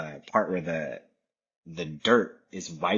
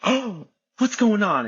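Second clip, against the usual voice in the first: high, loud and slow mock worry; hammed-up fussing mother; worried mom; 13 semitones higher, 6 dB louder than usual. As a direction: imitate a mom rushing in alarmed. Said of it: "she's like".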